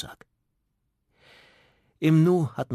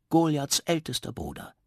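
usual speaking voice

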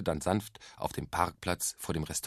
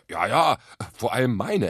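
proud fatherly aside; stolz; 3 semitones higher, 6 dB louder than usual. A narrator's reading. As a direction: add it with self-satisfaction. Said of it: "ergänzte Papa stolz". (stolz ergänzt)

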